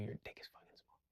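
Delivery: whispered, unvoiced aside. Real, not acted. To the men in the crowd, whispering. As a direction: breathe it out as a secret.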